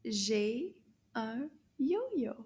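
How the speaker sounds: slow, word-by-word French modelling; drawn-out words with gaps between; slow teacher model. Shown as a demonstration. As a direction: say it slowly for learners to repeat. Teacher models sentence for repeating.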